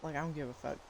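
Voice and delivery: usual speaking voice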